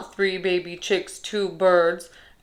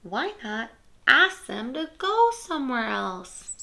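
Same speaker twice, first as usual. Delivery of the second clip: plain reading voice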